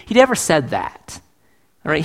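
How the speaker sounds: usual speaking voice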